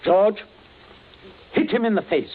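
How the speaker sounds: loud cold command; barked order. Acted. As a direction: call out the order sharply. Ordering a henchman.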